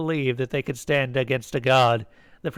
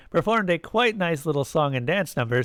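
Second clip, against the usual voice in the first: usual speaking voice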